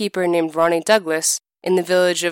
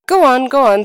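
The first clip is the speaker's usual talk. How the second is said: impatient urging; 6 semitones higher, 7 dB louder than usual. Acted. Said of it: "said his wife".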